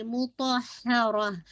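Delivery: मुतहहरा very slow, each h distinct; clear haa. Shown as a demonstration. Teacher models the word, slow recitation. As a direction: draw out मुतहहरा with clear h sounds. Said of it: "हा का sound".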